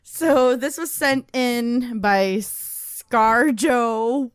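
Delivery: ordinary delivery, nothing marked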